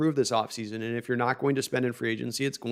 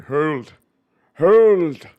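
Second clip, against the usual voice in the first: Sean Connery voice; 3 semitones higher, 9 dB louder than usual. Shown as a demonstration. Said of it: "I can do it like a, a Sean Connery".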